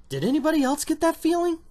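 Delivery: very high, baffled appeal; high-pitched incredulous question; comic disbelief. Acted. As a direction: ask it in a high mock-bewildered voice.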